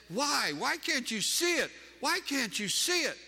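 high, anguished retold cry; imitated sobbing plea. Preacher voicing his sobbing friend begging him, anguished plea. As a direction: plead in exasperation, pitched far above your own voice. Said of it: "And she's sobbing".

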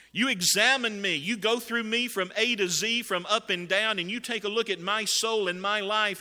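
loud, high impassioned plea; insistent, acted-out protest of innocence; David, appealing to heaven. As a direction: plead innocence to God at full voice. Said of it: "David is appealing to heaven".

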